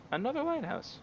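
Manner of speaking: squeaky exasperated echo; high exasperated repeat. Real, not acted.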